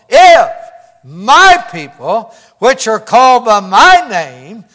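loud, emphatic scripture declamation; preaching scripture. Acted. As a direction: proclaim it forcefully, leaning on key words. Declaims God's words from scripture.

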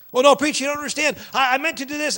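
high, loud excuse-making; protesting church member. Acted.

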